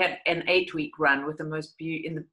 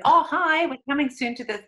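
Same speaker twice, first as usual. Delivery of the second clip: bright, high, breezy hello; cheerful imitation; 9 semitones higher, 5 dB louder than usual. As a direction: play it casual and cheery, unaware. Brother answers phone cheerfully.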